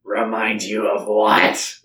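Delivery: slow, low creature voice; the Drode's voice. Shown as a demonstration. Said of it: "How does the Drode's voice go".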